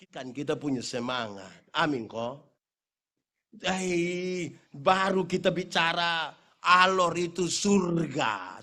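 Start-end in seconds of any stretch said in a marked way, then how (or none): none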